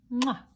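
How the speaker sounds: loud smacking goodnight kiss; kiss sound. Acted. Father hare kissing his son good night, loud kiss. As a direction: plant a big kiss.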